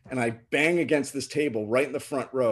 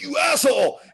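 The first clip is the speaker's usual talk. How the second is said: high, loud mock fury; retold outburst, pitched way up; imitated angry scream; 12 semitones higher, 5 dB louder than usual. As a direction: scream the insult as the furious boyfriend. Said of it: "And he's screaming".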